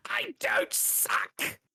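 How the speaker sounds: squeaky high whispered retort; mock dark elf. Recapping to the table, a high whispered elf retort.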